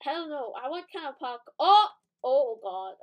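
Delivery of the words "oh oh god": startled outburst. Real, not acted. Gamer caught out mid-question about parkour, startled outburst. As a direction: yelp in real alarm, then a loud groaned oath. Sudden mishap while playing.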